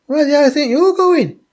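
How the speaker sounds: high, quick mock-indignant question; incredulous friend. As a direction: imitate the friend, pitched right up and rushed. Retold friend's startled question.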